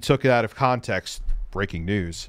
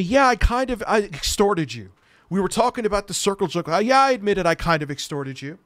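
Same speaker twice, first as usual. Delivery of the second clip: mock quote; 7 semitones higher than usual. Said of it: "he said to me".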